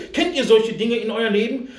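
usual speaking voice

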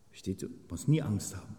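ordinary delivery

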